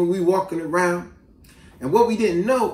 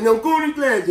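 higher-pitched mock order; imitated patrol kid; 6 semitones higher than usual. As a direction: order like a young hall monitor. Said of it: "He said".

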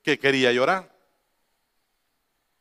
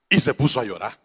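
ordinary talk, nothing marked

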